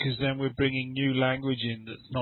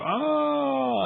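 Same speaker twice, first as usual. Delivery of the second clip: learner's realisation; 12 semitones higher, 4 dB louder than usual. Retold or imitated. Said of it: "and then you go".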